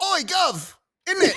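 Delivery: high, hammed-up British accent; mock British accent. Imitating kids back from London, in hammed-up British accent. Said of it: "just talking in full British accent".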